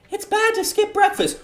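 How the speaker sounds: put-on bubbly, very high anime girl voice; cute high girl voice. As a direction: squeak it out like a cheerful schoolgirl. Voices Sayori.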